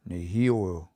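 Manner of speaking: nehio, longer form, stressed on hi; stress moved over one. Shown as a demonstration. Teacher shows the little change in nehio, stress on hi. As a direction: peak on hi in nehio, then fall. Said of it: "You hear that little change?".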